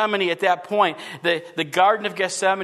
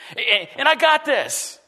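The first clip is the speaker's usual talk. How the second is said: slow, higher mock boast of confidence; smug self-assurance; 6 semitones higher, slower than usual. Preacher mimics a self-satisfied churchgoer, smug and slow. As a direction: play the cocky believer, drawing it out.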